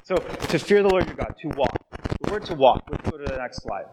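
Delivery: normal talking voice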